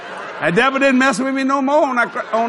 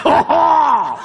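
higher mock-thrilled oh; three guys reacting; 6 semitones higher, 4 dB louder than usual. Three guys watching her pin him, mock-thrilled howl. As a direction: imitate onlookers whooping in a long oh. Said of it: "These three guys".

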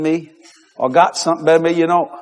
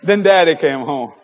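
normal talking voice

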